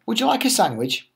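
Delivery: mummy's lifted hostess voice; bright mummy voice. Acted. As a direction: sing it out like a friendly mum. Mummy offers tiger food.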